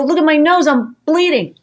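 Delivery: high, loud frantic plea; to the startled husband, a high loud panicked cry. Retold or imitated. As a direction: show him the blood, frantic. Showing bleeding nose to husband.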